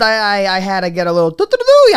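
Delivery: ordinary talk, nothing marked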